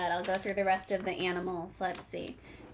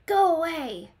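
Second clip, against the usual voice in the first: loud, higher grouchy shout; 8 semitones higher, 6 dB louder than usual. Acted. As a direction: shout it like a grumpy bug. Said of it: "shouted the grouchy ladybug".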